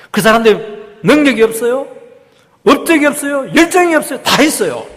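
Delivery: high, loud rapid-fire rhetorical questions; impassioned preaching. Real, not acted. Preacher challenging the congregation about gifted people, impassioned. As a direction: fire each question at the congregation, then answer it.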